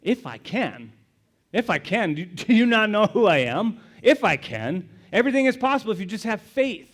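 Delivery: tough love. Acted. Jesus rebuking the doubting father, loud tough love. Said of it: "He's a little angry".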